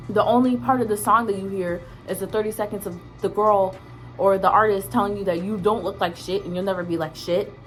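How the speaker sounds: ordinary talk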